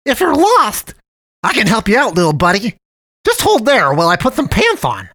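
put-on a friendly kids'-TV cat luring a lost boy; sing-song high cat voice, overly sweet; jolly purring cat man. A narrator's reading. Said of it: "jolly warmth of this new acquaintance's purring voice".

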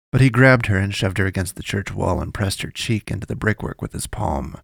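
ordinary delivery

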